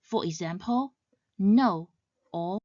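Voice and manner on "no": NO dropping sharply downwards; firm NO, pitch falling. Shown as a demonstration. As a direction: say NO with a quick fall, as in mild frustration. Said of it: "drops sharply downwards".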